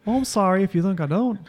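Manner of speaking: put-on Canadian accent. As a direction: play up a polite Canadian accent. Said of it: "You don't have a Canadian accent?".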